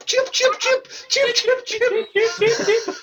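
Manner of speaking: high mock chicken chirp; chicken noises. Taunting Peter as a chicken, high mock chirp. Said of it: "What are you, chicken".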